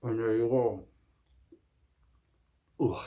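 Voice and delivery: muffled, mouthguard between teeth; talking with mouthguard in. Real, not acted. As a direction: speak round a gum shield. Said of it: "you shove it in".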